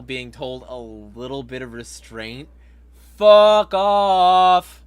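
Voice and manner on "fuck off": shouted, much louder; loud dismissive shout; loud outburst. Real, not acted. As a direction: bark it out at them. Angry outburst at governor's critics.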